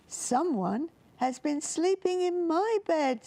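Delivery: high, squeaky cub voice; squeaky little bear. A narrator's reading. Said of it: "squeaked Little Bear".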